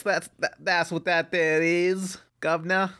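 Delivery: hammed-up mock British accent, slowed down; bad British accent. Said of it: "half Australian, half Irish right now".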